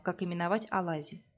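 usual speaking voice

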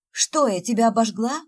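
worried she burned him; a sudden fright in the voice; alarmed. Acted. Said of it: "перепугалась Света".

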